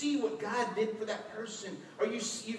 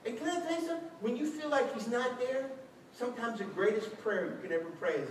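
normal talking voice

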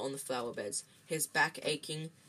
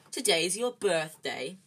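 slow, louder sneer; drawn-out, louder taunt; 2 semitones higher, 6 dB louder, slower than usual. A narrator's reading. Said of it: "sneered Dudley".

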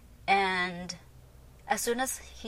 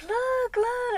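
very high, drawn-out squeal; high-pitched delighted insistence; excited mom. Retold or imitated. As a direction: urge them to look, imitating mom. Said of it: "Why is she so excited?".

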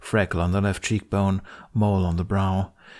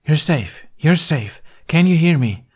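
reassuring; 8 semitones higher, 6 dB louder than usual. A narrator's reading. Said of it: "A junior healer appeared".

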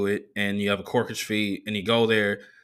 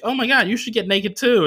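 imitating her; 11 semitones higher, 4 dB louder than usual. Comic voicing the flirty woman in a high put-on voice. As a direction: tease him into the hot tub. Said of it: "she was like".

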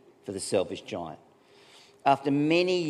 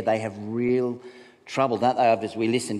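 ordinary talk, nothing marked

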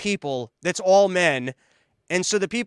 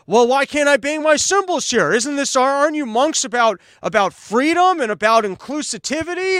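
higher, louder whiny protest; mock indignant complaint; 6 semitones higher, 4 dB louder than usual. Entitled visitor demanding to bang cymbals at the monks, indignant mock protest. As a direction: play an entitled visitor outraged at the rule.

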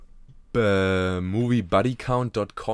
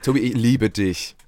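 mock recut confession; 7 dB louder than usual. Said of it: "und dann cuttet er so".

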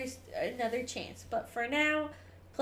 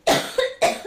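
loud spluttering cough; choking cough; 11 dB louder than usual. Real, not acted. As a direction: hack it out, choking. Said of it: "I'm choking".

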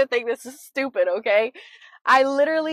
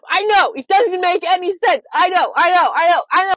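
1 exasperated loud; 7 semitones higher, 10 dB louder than usual. Real, not acted. Defending herself to audience.

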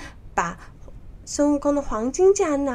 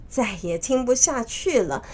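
plain reading voice

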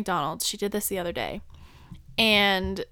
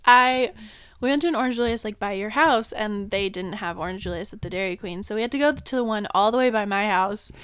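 normal talking voice

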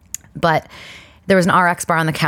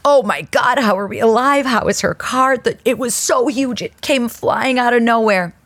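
higher, breathless retelling of the shock; retold shock; 6 semitones higher than usual. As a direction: replay the panic from the car. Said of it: "We were like".

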